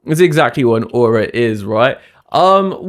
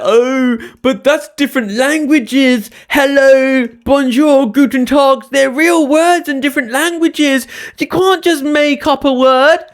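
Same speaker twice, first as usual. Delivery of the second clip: higher, whiny know-it-all voice; mock commenter; 8 semitones higher than usual. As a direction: parody an indignant commenter. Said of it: "in the comments".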